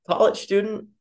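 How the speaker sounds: high, prying guess at college student; curious stranger. Retold or imitated. Nosy stranger guessing, high and curious. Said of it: "they're like".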